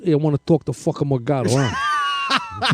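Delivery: low, mock New York tough caller; New York accent. Co-host mimics an angry caller, in a New York accent. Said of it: "this guy from New York".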